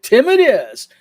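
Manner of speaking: bright laughing concession; cheerful agreement. Retold or imitated.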